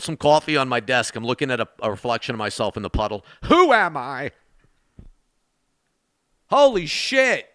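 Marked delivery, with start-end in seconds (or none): 3.4-4.3 high, hammed-up cry of confusion; playful existential wail; mock identity crisis. Mock identity crisis at puddle reflection. 6.5-7.5 high, drawn-out amazement; stunned reaction to an unexpected gift; real astonishment. Real, not acted. Reacts to a surprise donation.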